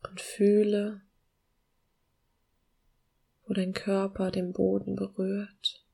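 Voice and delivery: slow, soft meditation voice with long pauses; calm guiding voice, gently slowing down; guided meditation. Acted.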